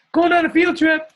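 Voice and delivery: very high, loud sing-out; playful excitement. Pretend. Host announces a screen tour to attendees, playfully excited squeal. Playful repeat after laughing.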